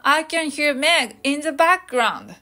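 slow, clear "I can hear Meg in the background"; slow teaching example; slow model sentence. Shown as a demonstration. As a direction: model it slowly for learners. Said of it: "音がただ耳に入ってきている状態の場合は".

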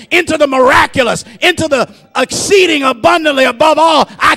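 high, driving pulpit plea; rousing preacher's appeal; full-force preaching. Real, not acted. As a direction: cry out to God in rolling, forceful phrases.

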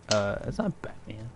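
hushed, breathy whisper. Retold or imitated. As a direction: whisper it in disbelief. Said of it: "was like".

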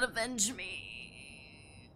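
slow, soft dying-hero plea; dramatic plea. Pretend. Hammed-up plea to teammates to avenge her, slow. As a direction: ham it up like a fallen hero. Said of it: "dramatic plea".

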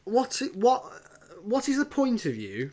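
high, pleading disbelief; exasperated. Retold or imitated. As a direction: beg the screen to make sense. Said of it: "I'm just going".

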